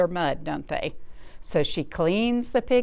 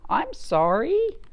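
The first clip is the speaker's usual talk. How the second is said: apologetic farmer; 6 semitones higher than usual. Acted. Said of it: "says the farmer".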